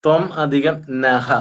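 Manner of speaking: slow ത്വം, then നഹ as its own word; words split apart. Shown as a demonstration. Teacher splitting the compound, slow separate ത്വം and നഹ. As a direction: separate ത്വം from നഹ, sounding the final "ha". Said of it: "അത് പിരിക്കണം".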